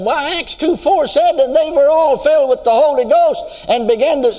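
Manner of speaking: glib recitation, pitched high and rising in force; high, confident quoting that breaks off mid-phrase. Retold or imitated. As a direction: rattle off the verse high and cocksure. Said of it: "Very glibly, I said".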